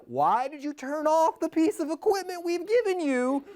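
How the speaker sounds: incredulous controller wanting answers; exasperated demand. Retold or imitated. Ground control to Luke, exasperated high question.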